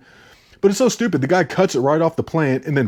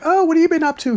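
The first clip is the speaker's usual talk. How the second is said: much higher-pitched imitation of a girl; 4 dB louder, faster than usual. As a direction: put on a high girly voice.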